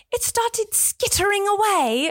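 put-on high, drawn-out little-girl British accent; cutesy high British accent, stretched out; imitating English child. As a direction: mimic a small English girl, slowly. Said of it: "she was like".

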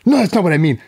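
mock indignant correction; wife correcting. Wife insisting she meant something else, high and fast. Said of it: "And she's like".

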